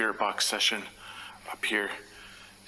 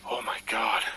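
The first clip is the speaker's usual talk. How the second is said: scared whisper. Real, not acted. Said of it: "My heart is racing".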